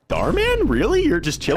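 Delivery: high, startled disbelief; squeaky, playful double take; mock surprise. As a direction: act stunned to see him. Surprised to meet Dhar Mann.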